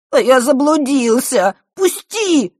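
high, slow whiny wail; cartoon crybaby. A narrator's reading. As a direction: whine like a crying kid. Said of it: "плаксивым голосом".

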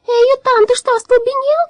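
high, loud metallic shout; shouting robot voice. A narrator's reading. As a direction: yell it at the top of your lungs, high. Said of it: "кричал Дром во всё горло".

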